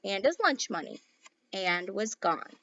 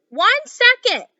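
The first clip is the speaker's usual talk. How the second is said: shouted reply; 12 semitones higher, 11 dB louder than usual. Acted. Boy answering his mother from upstairs, loud high shout. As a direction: holler back down the stairs.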